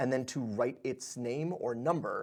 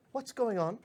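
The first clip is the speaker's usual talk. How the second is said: lightly voiced pixie, higher; a puzzled pixie asking; worried pixie; 8 semitones higher than usual. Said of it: "the pixie goes".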